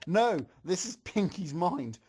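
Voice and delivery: ordinary talk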